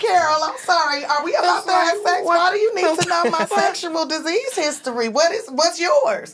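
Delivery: hammed-up prying tourist voice; mock drunk tourist. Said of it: "they be like".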